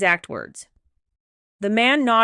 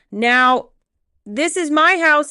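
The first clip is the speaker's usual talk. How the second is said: loud, entitled, pitched up high; lawyer voice; 7 semitones higher, 7 dB louder, slower than usual. Acted. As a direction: assert ownership like a cornered lawyer. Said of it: "Derek found his voice, his lawyer voice".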